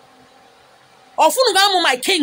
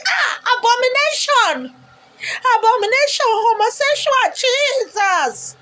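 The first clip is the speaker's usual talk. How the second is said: high, shocked preacher cry; mock outraged pastor; 9 semitones higher, slower than usual. Mocking a pastor shocked by the Apple logo, high outrage. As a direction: clutch your pearls like a scandalised pastor.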